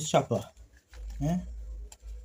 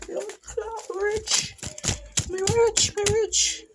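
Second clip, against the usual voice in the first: much higher put-on squeaky voice; squeaky boast; 4 dB quieter, much slower than usual. Vlogger signing off in a squeaky voice about being rich. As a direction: chirp it like a tiny cartoon voice.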